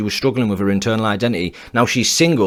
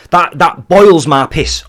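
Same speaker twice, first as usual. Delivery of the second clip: boiling anger; 3 semitones higher, 5 dB louder, slower than usual. Real, not acted. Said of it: "watch me change when I read that".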